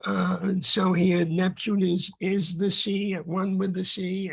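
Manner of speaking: usual speaking voice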